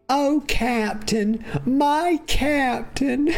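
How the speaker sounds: mock weeping tribute, milk every word; over-the-top devoted sidekick. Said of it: "He didn't say that, y'all. I added it".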